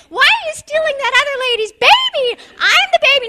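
put-on high, loud toddler whine; a toddler's high, loud, upset protest. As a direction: whine it like a jealous two-year-old. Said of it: "your two-year-old is saying".

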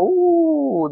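very high, drawn-out ooh; impressed ooh. Real, not acted. Gamer sees a clanmate's loot, high impressed ooh. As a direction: squeal at the loot. Reacting to clan drop.

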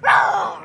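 loud playful monster roar; startling roar. Pretend. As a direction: roar to scare everyone. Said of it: "Did I scare you?".

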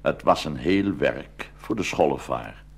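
ordinary delivery

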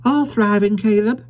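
put-on high, cheerful young wife; high wife's voice, glad. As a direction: answer the visitor brightly. Wife answering Caleb.

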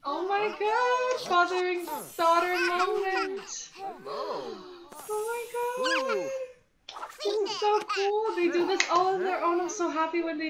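high, loud, gushing squeal; squealing delight. Real, not acted. Player watching dad and daughter hug, high excited squeal. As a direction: melt over the sweet moment.

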